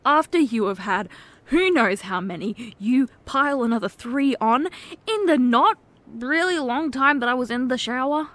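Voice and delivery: ordinary talk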